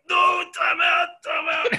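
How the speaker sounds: high, loud retold yell of time out; panicked shout. Jouster lying winded as a man charges with a mace, high panicked shout. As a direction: cry out to stop the fight, half laughing.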